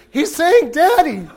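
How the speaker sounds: high, loud, laughing excited father; slow delighted cry of a laughing father; excited dad. Retold or imitated. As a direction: gush like a thrilled new dad. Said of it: "displays an extraordinary degree of excitement".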